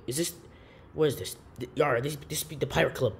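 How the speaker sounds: ordinary talk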